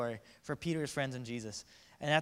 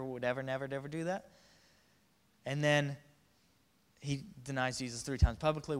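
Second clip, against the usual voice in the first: usual speaking voice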